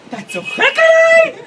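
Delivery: very high, loud, slowed imitation of her shriek; mock shriek. Boyfriend imitating girlfriend's shriek, very high and laughing. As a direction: parody her squeal with a laugh.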